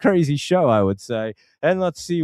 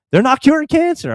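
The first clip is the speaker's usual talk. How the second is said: pitched way up and louder, insisting; emphatic outburst; 14 semitones higher, 6 dB louder than usual. Real, not acted. Host insisting to his viewers that there's no cure, emphatic outburst. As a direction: hammer home that they're not curing it.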